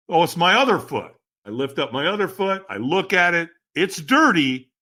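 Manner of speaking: ordinary talk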